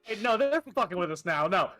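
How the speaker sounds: normal talking voice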